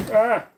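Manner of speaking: short, loud, high-pitched "Ah!"; sudden yelp. Pretend. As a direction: let out an abrupt yelp on purpose. Announced burst for a viewer.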